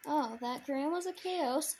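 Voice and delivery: usual speaking voice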